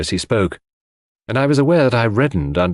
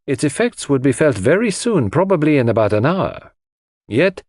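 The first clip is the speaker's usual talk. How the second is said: put-on higher-pitched, animated accented detective; Poirot, reasoning; 6 semitones higher than usual. A narrator's reading. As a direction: reason it through emphatically, point by point.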